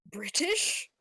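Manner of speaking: imitating your own excited double-take; retold startled excitement. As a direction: act thrilled at an unexpected discovery. Retells startled delight at a voice.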